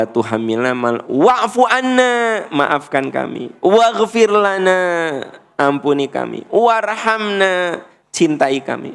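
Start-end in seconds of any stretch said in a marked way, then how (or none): 1.1-2.4 high, drawn-out prayer cry; impassioned plea. Shown as a demonstration. Preacher reciting a plea for forgiveness, impassioned. 3.6-5.2 high, drawn-out prayer cry; impassioned plea. Shown as a demonstration. Preacher reciting a plea for forgiveness, impassioned. 6.5-7.9 high, long-held prayer cry; impassioned plea. Shown as a demonstration. Preacher praying for God's love, impassioned.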